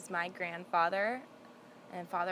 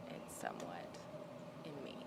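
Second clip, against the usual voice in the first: whispered aside; 6 semitones lower, 15 dB quieter, faster than usual. Real, not acted.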